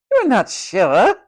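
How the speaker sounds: slow, loud, high echo with rising end; loud incredulous matron. A narrator's reading. Matron humiliating Tom before the ward, loud incredulous echo. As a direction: repeat it loudly in scandalised disbelief. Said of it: "said the matron loudly".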